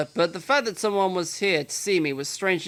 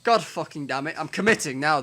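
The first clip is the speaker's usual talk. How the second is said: ordinary delivery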